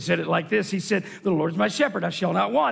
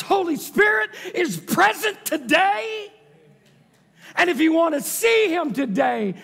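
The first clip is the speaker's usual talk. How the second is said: high, loud, rousing preacher; forceful preaching; 6 semitones higher, 4 dB louder than usual. Real, not acted. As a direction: drive it home with a raised voice.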